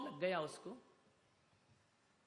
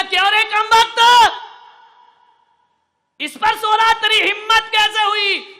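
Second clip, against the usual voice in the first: a much higher, much louder furious shout; furious king; 21 dB louder than usual. Acted. King to the sleeping gardener, furious shout. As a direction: roar at the servant who dared sleep on your bed. King catches servant in bed.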